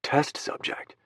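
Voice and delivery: unvoiced bitter whisper. A narrator's reading. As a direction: breathe it out as a whisper. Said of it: "as I repeat them to myself".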